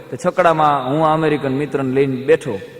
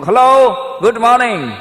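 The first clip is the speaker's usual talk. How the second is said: bright, raised hello from the visiting European; cheery foreigner greeting; 7 semitones higher, 6 dB louder than usual. Retold or imitated. European guest greeting the neighbour across the street, cheery and raised. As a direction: call across the street like a chirpy tourist.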